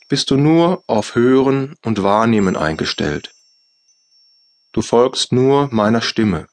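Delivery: ordinary talk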